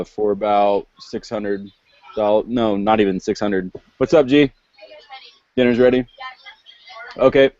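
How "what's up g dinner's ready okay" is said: raised voice, calling to another room; yelling across the room. Real, not acted. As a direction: call out to someone away from the mic. Answering someone off-mic about dinner.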